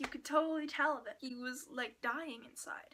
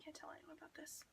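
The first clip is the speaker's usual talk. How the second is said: hushed secret; 3 semitones higher, 9 dB quieter, faster than usual. Retold or imitated. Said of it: "he was like".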